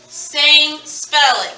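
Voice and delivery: usual speaking voice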